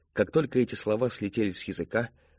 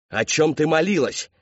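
loud, high-pitched angry shout; furious roar; 7 semitones higher, 7 dB louder than usual. A narrator's reading. Father roaring at his daughter, loud fury. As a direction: roar the question at her. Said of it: "взревел отец".

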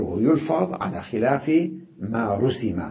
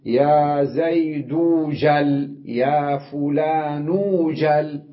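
very slow, each word drawn out; slow demo of يا زيد جل, the waw sound heard before جل; slow demonstration; much slower than usual. Shown as a demonstration. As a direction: spell it out slowly for students. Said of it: "نقول مثلًا".

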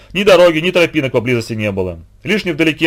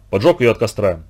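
ordinary delivery, nothing marked

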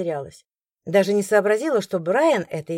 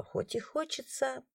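a soft, slow aside; under breath; 6 semitones higher, 12 dB quieter, slower than usual. A narrator's reading. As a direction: mutter the wish to herself. Muttered aside.

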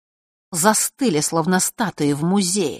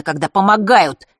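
angry outburst; 3 semitones higher, 7 dB louder than usual. A narrator's reading. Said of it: "гневно выпалил Фэш".

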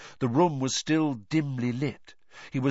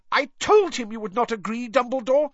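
high, angry, raised-voice protest; McGonagall storming; 4 dB louder than usual. A narrator's reading. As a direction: storm at the culprit's decision in a pitched-up voice. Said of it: "stormed Professor McGonagall".

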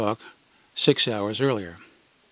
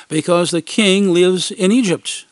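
put-on slow, raised kid lecture; arguing kid; 5 semitones higher, 5 dB louder than usual. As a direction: explain it like an insistent child. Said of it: "they argued".

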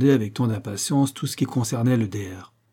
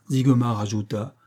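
usual speaking voice

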